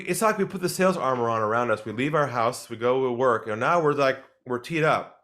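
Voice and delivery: ordinary talk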